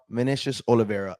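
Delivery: ordinary talk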